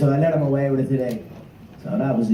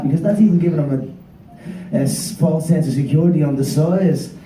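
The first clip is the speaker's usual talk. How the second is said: ordinary delivery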